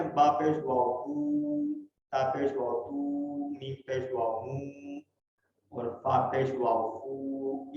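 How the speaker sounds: slow, with بو تو مو فو each held long; long drawn بو تو مو فو; long u vowel. Shown as a demonstration. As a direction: stretch every u sound. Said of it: "اس میں لمبا آواز کریں گے".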